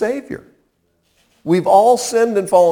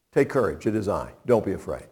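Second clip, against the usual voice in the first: reassuring; 8 semitones lower, 8 dB quieter, faster than usual. Acted. Jesus reassures terrified disciples.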